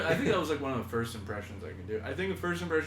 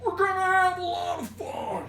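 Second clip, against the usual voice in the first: very high shouted voice; Farley yell; 6 dB louder than usual. Retold or imitated. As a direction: squeal it out loud. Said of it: "Farley was definitely".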